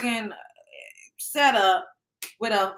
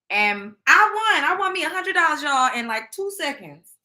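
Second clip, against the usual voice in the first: excited bragging; 7 semitones higher, 5 dB louder than usual. Real, not acted. Bragging about winning money.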